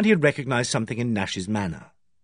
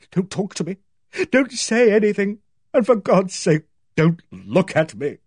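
high, mounting desperate rebuff; distraught plea; 8 semitones higher, 4 dB louder than usual. A narrator's reading. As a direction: beg him off, building from tight to loud. Distraught sister rebuffs brother as she leaves.

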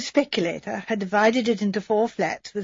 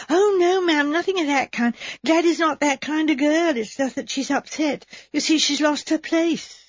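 higher, hurried protesting maid; flustered maid; 6 semitones higher than usual. Acted. Maid Edna assuring Miss Marple her cousin is innocent, higher and flustered. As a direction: rush to defend her cousin.

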